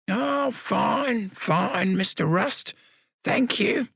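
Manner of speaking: put-on high, slow old man's voice; wry old convict, stretching out still alive; frail old man. Samuel answers Rust.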